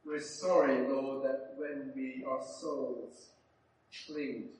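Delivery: low, earnest public prayer. Real, not acted. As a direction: lead a congregation in solemn repentance. Leads closing prayer.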